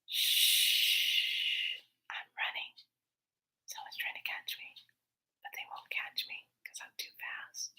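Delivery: soft urgent whisper; secretive breathy whisper to a child. Retold or imitated. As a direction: hush the kid while hiding. Hiding from pursuer, hushing child.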